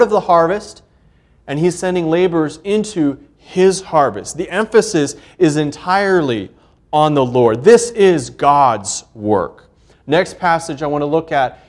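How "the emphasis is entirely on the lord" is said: ordinary delivery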